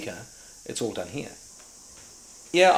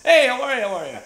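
loud, high American holler; booming Americans; 14 dB louder than usual. Retold or imitated. Producers in the boardroom greet the actor, booming American accent. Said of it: "it was like six Americans".

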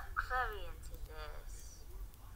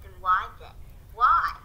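demanding; 10 dB louder than usual. Acted. In the scripted argument, a loud demand for a reason. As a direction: press for an answer. Acted quarrel in scene.

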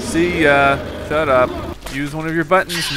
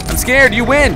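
high, louder plea of surrender; scared cry; 7 semitones higher, 5 dB louder than usual. Real, not acted. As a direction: cry it out, giving up in a panic. Repeats fear, raised voice.

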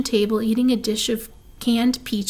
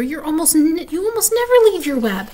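high-pitched protest; puzzled young pig; 6 semitones higher, 4 dB louder than usual. A narrator's reading. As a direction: insist high, in surprise. Wilbur puzzled by her absence.

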